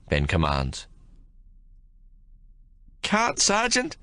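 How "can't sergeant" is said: put-on high, whimpering young soldier near tears. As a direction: plead weakly, close to crying. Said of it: "Cooligan whimpers".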